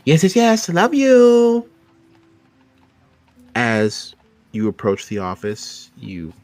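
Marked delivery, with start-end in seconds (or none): 0.1-1.7 high, loud, sing-song farewell; Linda's bubbly love you; gushing goodbye. Acted. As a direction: send her off with a big fond cheer.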